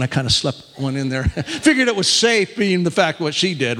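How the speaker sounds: ordinary talk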